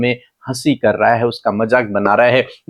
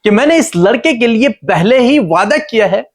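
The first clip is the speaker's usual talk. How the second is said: higher-pitched, insistent divine reassurance; God explaining; 8 semitones higher than usual. Retold or imitated. God explains his promise to the worried Hagar, raised insistent voice. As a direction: pitch it up and press each question home as God. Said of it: "परमेश्वर उसे समझाने की कोशिश कर रहे हैं".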